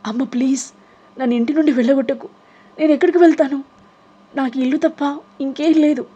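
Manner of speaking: high sobbing plea to mother; crying plea. Acted. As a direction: beg through tears not to be thrown out. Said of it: "Aaruhi ఏడుస్తూ".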